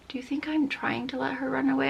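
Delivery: whispered indignant aside; silent thought. Retold or imitated. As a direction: whisper it like an unspoken retort. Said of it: "And I'm thinking".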